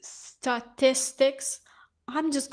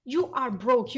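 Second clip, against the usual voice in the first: usual speaking voice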